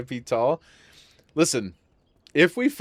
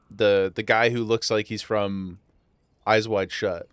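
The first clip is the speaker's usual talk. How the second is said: normal talking voice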